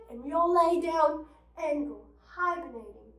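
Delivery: ordinary talk, nothing marked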